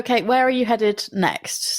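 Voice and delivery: ordinary talk, nothing marked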